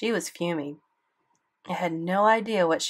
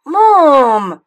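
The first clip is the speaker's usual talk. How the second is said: much louder, higher pleading warning; through clenched teeth; 9 semitones higher, 16 dB louder than usual. A narrator's reading. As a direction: hiss it through your teeth. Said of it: "clenching my teeth".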